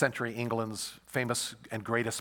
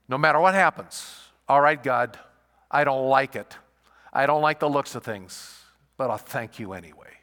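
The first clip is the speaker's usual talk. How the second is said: resigned prayer; 3 semitones higher, 10 dB louder than usual. Retold or imitated. Habakkuk resigned to God.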